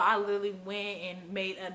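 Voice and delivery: ordinary talk, nothing marked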